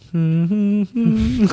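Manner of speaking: high mock soothing hum; a teasing high hum while tending his hands; mock humming. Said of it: "Like, she's making, like, this sound".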